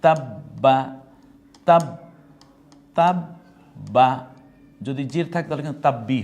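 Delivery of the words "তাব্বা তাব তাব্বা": loud তাব্বা broken into tab and ba; emphatic, slow syllable demonstration of তাব্বা; split doubled consonant. Shown as a demonstration. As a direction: close firmly on the b, then release ba in তাব্বা. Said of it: "একটা হলো তাব আর একটা হলো বা".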